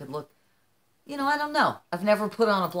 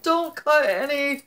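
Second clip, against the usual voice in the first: anxious plea; 9 semitones higher, 6 dB louder, slower than usual. Retold or imitated. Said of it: "'cause I was very like".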